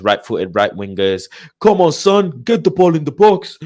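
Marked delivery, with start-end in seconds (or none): 1.6-3.6 loud, high-pitched urging from the sidelines; old-school touchline shout. Retold or imitated. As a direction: yell it like an old-school football fan. Mimics old-school long-ball shouting.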